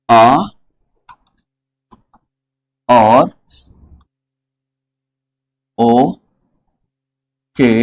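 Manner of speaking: spaced-out deliberate phrasing; teacher spelling it out word by word; slow, word by word. Shown as a demonstration. Teacher dictating each word slowly to students. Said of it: "प्रश्न आता है".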